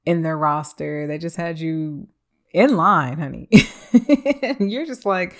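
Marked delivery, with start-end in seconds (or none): 2.5-3.5 sassy. Acted. Mocking the ex's roster. 3.5-4.4 a quick high burst of laughter; high bright laugh. Real, not acted. Laughing at the ex's roster.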